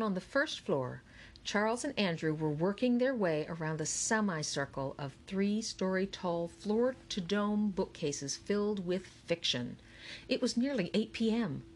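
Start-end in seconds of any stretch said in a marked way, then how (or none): none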